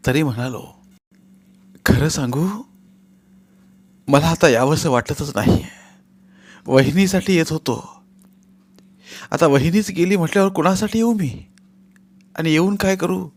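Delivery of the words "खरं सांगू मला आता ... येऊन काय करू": tearful. Acted. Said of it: "मला हुंदके येत होते".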